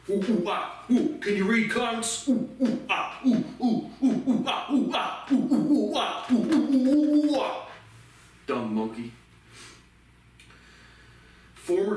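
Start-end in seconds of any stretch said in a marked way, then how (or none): 0.1-1.2 taunting monkey call, pitched high; mocking monkey hoots. 1.2-2.3 squeaky, much higher jeer; mock question in a high voice; high mocking taunt. Taunting Thomas in monkey act. 2.3-7.7 very high, loud ape hooting that builds; taunting monkey calls, climbing in pitch; mocking monkey hoots.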